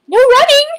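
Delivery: high loud scolding call; calling after. Acted. Librarian calling the rule after the running lion, high and loud. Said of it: "called after him".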